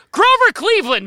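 high loud exasperated outburst; exasperated blurt. Real, not acted. Host blurting the answer at forgetful co-host, high and loud. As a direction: blurt the name out in disbelief.

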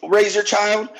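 ordinary delivery

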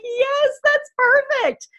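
drawn-out, beaming approval; delighted yes. Retold or imitated. Repeats the doctor's delighted approval.

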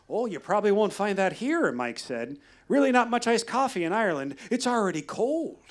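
Irish guide. Retold or imitated. Quoting Irish guide Mike.

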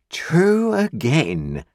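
high, very slow drawn-out agreement; pompous agreement. A narrator's reading. Pumblechook agreeing with Wopsle, high and very slow. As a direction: savour the agreement. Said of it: "said Uncle Pumblechook".

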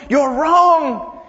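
loud, high, drawn-out accusation; high-pitched, stretched-out pulpit shout; harsh rebuke. Shown as a demonstration. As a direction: thunder it slowly like a condemning preacher. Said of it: "he says it like this".

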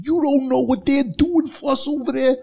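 high-pitched indignant rebuke; retold angry outburst. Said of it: "And he let him have it".

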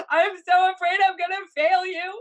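high, loud, tearful panic; hysterical. Retold or imitated. Wife sobbing to husband on the stairs, hysterical. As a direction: wail it out like you're falling apart. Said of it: "I mean, I'm hysterical".

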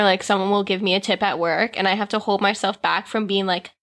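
usual speaking voice

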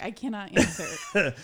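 laughing through it, slowed down. Retold or imitated. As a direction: laugh out the refusal. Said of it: "I'm just like".

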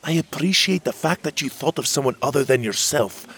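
high, loud, put-upon fairy voice; fairy captain annoyed at the boy; grumpy fairy scolding. Acted. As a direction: scold him for meddling with your ship. Magborn scolds Will.

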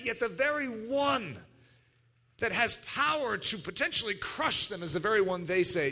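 ordinary talk, nothing marked

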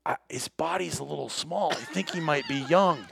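doubtful murmur in the blind; quiet quote. Retold or imitated. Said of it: "quote, it's on tape".

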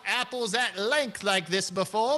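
a lightly voiced courtly old man; genteel old gentleman. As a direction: talk like a fussy, kindly old gent. Bartimus reminisces.

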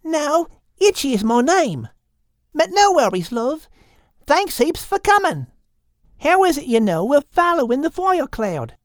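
high, friendly Aussie lizard; high Australian lizard, welcoming; Itchy the lizard. Acted. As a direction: greet them high and cheery, outback style. Itchy corrects Bethu.

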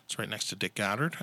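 ordinary talk, nothing marked

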